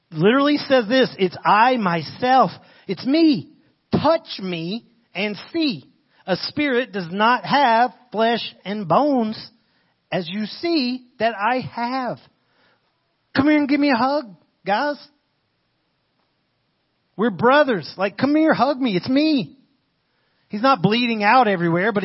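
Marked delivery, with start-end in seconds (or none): 1.2-12.2 slow, raised, word-by-word insistence; high, deliberate proclamation; emphatic Jesus. Acted. Voicing Jesus reassuring the startled disciples. 13.3-19.6 high, loud, urgent invitation; raised-voice, excited pleading; eager Jesus. Acted. Jesus urging his friends to hug him.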